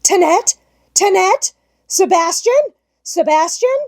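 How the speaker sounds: high, loud calls for the servants; calling loudly. A narrator's reading. Governess calling loudly for the servants. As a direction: call out for help in panic. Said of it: "call loudly".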